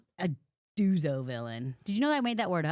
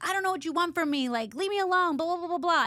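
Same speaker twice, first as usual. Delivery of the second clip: higher, exasperated quoted heroine; heroine pushing him away; 9 semitones higher, 3 dB louder than usual. Retold or imitated. Retelling the heroine shoving the hero off, higher and exasperated. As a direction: push him off with a higher, fed-up voice.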